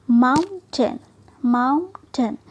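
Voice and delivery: माउंटेन split as maun, then ten; two syllables. Shown as a demonstration. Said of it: "तो यहाँ दो syllable". (माउंटेन को माउन-टेन तोड़कर बोलें)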